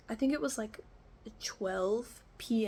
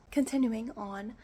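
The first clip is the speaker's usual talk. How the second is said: usual speaking voice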